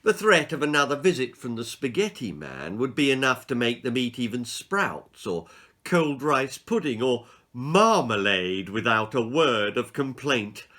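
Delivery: ordinary delivery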